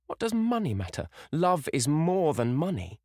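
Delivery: put-on high young girl, fervent and slowing; girl's passionate protest. A narrator's reading. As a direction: defend love over money. Sybil protesting to mother.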